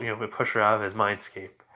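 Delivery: plain reading voice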